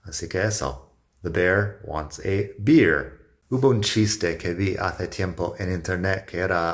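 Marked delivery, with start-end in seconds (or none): 1.2-3.2 slow, careful "The bear wants a beer."; slow model sentence. Shown as a demonstration. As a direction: read it slowly for learners.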